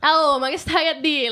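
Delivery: loud, high, drawn-out Roman Italian; strong Roman accent. Retold or imitated. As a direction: answer back in a thick Roman accent. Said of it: "I have the strongest accent".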